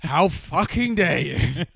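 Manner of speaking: loud, high, drawn-out indignation; hammed-up slow, loud protest; mock outrage. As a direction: stretch each word in comic fury, near laughing. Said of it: "That is absolutely outrageous".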